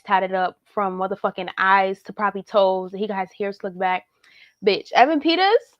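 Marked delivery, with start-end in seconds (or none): 4.6-5.7 high and loud, thrilled; gushing over him; excited. Real, not acted. Excited about the actor.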